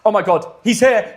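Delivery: normal talking voice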